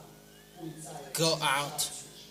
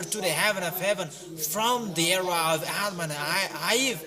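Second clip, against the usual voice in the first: loud, pounding sermon delivery with higher pitch; fiery preaching; 3 semitones higher, 4 dB louder than usual. Real, not acted. Preacher condemns the unbelievers in a loud, driving sermon. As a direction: preach it hard to the crowd, almost shouting. Preacher rising again in exhortation.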